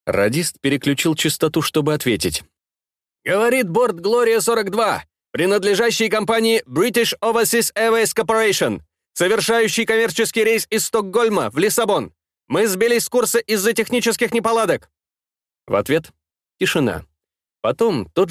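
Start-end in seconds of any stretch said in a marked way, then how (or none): 3.2-14.9 higher, formal radio call; radio operator voice. Acted. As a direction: read it like a pilot's radio report. Airliner radio operator answers.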